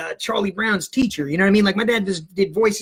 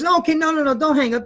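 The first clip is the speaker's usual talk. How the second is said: higher-pitched quick protest; hasty teacher; 9 semitones higher than usual. Retold or imitated. Teacher on the phone stops the kid hanging up, higher and urgent. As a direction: cut in to stop the caller.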